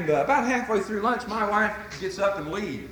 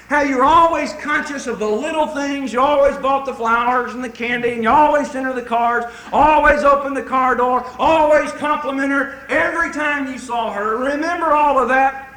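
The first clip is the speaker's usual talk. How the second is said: loud, high, rolling list with punched openings; full-force preaching; 5 semitones higher, 8 dB louder than usual. Real, not acted. Preacher reminding husbands of their dating days, full-force. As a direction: pile up each example with preaching fervor.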